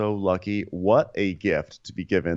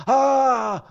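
high wailing fake sob; hammed-up crying howl; mock sob; 6 dB louder than usual. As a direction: let out a loud pretend bawl. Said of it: "Bigger sob".

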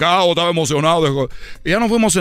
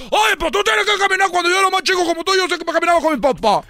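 much higher mock-stern father lecture; lecturing dad; 10 semitones higher than usual. Father lectures his tired son, hammed-up higher scolding. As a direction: preach like a know-it-all dad.

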